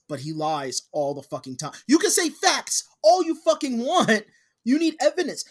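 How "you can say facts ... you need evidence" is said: high, loud, exasperated insistence; heated rant. Real, not acted. Ranting at liars who claim facts, heated. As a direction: hammer it home, voice raised.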